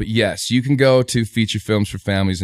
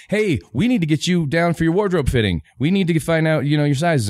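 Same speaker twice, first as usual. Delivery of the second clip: higher voice for the woman calling, brisk and businesslike; lady on the phone; 7 semitones higher than usual. Retold or imitated. Wardrobe lady calls him about his fitting, higher and brisk. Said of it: "it's this lady going".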